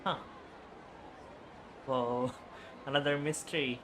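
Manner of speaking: ordinary talk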